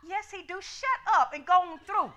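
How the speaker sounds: ordinary delivery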